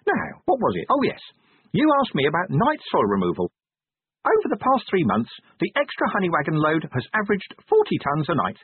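high-pitched little imp delivering a report; high, busy imp voice; officious imp. Acted. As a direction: recite the report as a small, officious creature.

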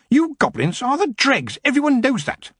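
high, sharp indignant outburst; queen shouting. A narrator's reading. Queen to goblin, indignant shout. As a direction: snap at the goblin in outrage.

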